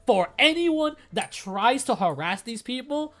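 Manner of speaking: fierce vow. Real, not acted. Vowing to defend her friends.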